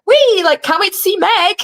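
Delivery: high, bouncy and giddy, like skipping along; giddy excitement. Retold or imitated. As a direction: squeal it like a kid on the way to a treat. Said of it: "I'm, like, skipping".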